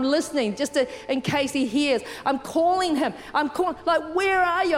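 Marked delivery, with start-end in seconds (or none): 4.1-4.8 higher-pitched mock call for a lost child. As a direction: act out calling for your son, without a full shout. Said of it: "I'm calling out his name".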